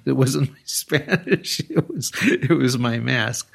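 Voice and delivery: chuckling through the words; slow down as laughter breaks into the words; laughing. Real, not acted. Storyteller amused at what gave them away, laughing. Chuckling at own mask anecdote.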